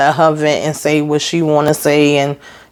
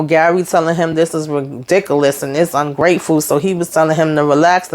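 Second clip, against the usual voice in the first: ordinary delivery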